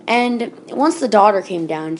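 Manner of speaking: ordinary delivery, nothing marked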